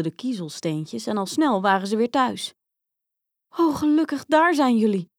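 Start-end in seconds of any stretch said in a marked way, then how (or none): 3.5-5.1 raised, lightly acted cry of relief; relieved calling out. A narrator's reading. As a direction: call out as parents relieved to find their children. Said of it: "riepen hun ouders opgelucht". (opgelucht uitroepen, licht gespeeld)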